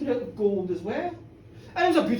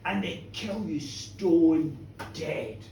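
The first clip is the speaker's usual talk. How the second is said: low and very slow, dragging out stone dead; slow grim doom; 9 semitones lower, much slower than usual. A narrator's reading. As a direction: lay the death down heavily. Grim punchline of death.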